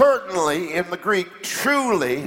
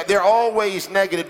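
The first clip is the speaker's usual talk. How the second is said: usual speaking voice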